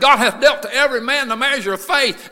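verse cried out high and loud; full-force preached scripture. Real, not acted. Preacher driving the Romans verse home, full-force preaching.

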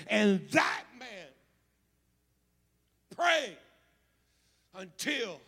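loud, impassioned pulpit delivery, building to a shout; full-force preaching. Acted. Preacher vowing not to need a custom-built prayer room, preaching at full force. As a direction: drive each phrase home to the congregation.

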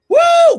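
high, loud whoop of joy; ecstatic woo. Real, not acted. Preacher whooping in praise to the church, loud woo. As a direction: whoop it out, full voice. Ecstatic cry mid sermon.

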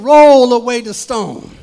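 Jesus ordering the stone moved, loud; commanding. Retold or imitated. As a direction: call it out to the crowd. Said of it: "he said".

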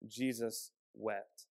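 slow and soft, almost reverent; hushed reverence. Real, not acted. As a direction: let the two words hang. Reading the shortest verse.

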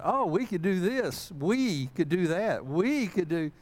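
high, loud mock enthusiasm, punching "we"; imitating eager wife. Husband mimicking wife joining the project, mock eager. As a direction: mimic her eager planning, big swoop on each "we".

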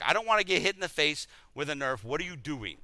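ordinary talk

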